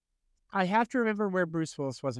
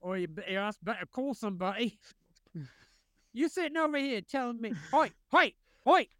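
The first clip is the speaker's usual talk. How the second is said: mock Cockney; 4 semitones higher than usual. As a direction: bark it like a cartoon Cockney geezer. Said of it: "the speech in Cockney".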